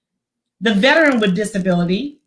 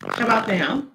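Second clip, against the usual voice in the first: robotic distortion; 5 semitones lower, 8 dB quieter than usual. Real, not acted. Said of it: "you sound a little distorted".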